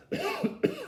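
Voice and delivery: cough. Real, not acted. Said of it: "often makes me cough".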